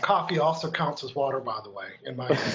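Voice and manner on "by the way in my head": chuckling through the words, lower and softer; a joking aside, laughed out; amused half-laugh. Real, not acted. Joking aside about coffee.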